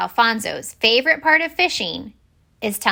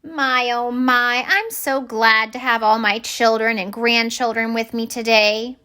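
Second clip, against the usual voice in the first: doting grandmother fish; 3 semitones higher than usual. Acted. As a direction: savor every word like a proud granny.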